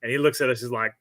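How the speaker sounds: plain reading voice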